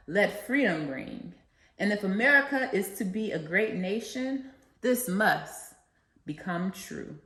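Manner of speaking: rousing oratory with a preacher's cadence; formal speech. Acted. Student reciting the I Have a Dream speech to listeners, rousing oratory. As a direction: proclaim each line like a civil rights speech to a crowd.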